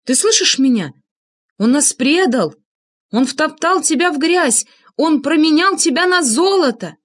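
high, emphatic accusation; heated insistence. A narrator's reading. Narrator trying to reach weeping Анна, heated insistence. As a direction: press each betrayal home, agitated. Urging grieving woman to see betrayal.

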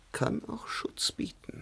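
a voiceless whisper, slowed down. Acted. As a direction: drop into a secret whisper. Confides a paradox about masks.

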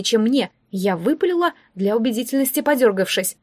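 plain reading voice

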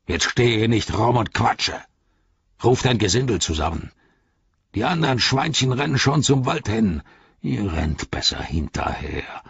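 orc barking orders; bossy orc, rising to urgent commands; Ugluk, commanding. Acted. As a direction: drive the rival on impatiently.

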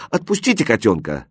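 ordinary delivery

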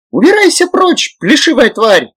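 very high, loud squealing yell; screaming gremlin. A narrator's reading. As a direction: screech it at the beast. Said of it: "завопил гремлин".

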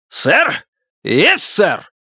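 high barked military shout; stiff barking toy soldier. A narrator's reading. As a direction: bark it at attention. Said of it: "орёт Шафто". (армейский рявк)

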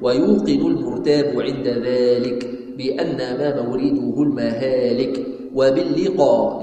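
measured, drawn-out chanting of the poem; slow verse recitation. Acted. Teacher reciting the creed poem to students, slow chant. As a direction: give each line of the poem its full slow weight.

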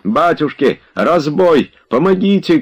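high, alarmed cries; cries for help. A narrator's reading. Tarantyev calls out robbery, crying for help. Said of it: "кричал Тарантьев".

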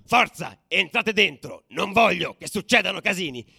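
loud, high commanding shout; megaphone yell. Retold or imitated. Commissioner yelling at fans through megaphone, loud command. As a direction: bark orders through a megaphone. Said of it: "Prende il megafono ... e urla".

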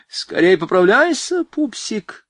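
high, drawn-out playful call. A narrator's reading. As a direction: call it out teasingly, high-voiced. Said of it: "крикнула она Звонарёву". (игривый высокий оклик)

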